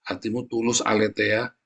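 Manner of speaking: ordinary talk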